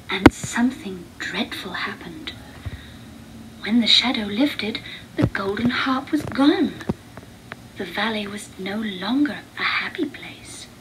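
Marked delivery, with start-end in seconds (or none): none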